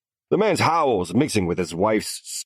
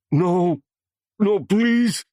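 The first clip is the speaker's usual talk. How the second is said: panicked begging; 13 semitones higher, 7 dB louder, much slower than usual. A narrator's reading. Captive begs for his wife.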